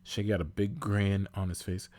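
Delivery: usual speaking voice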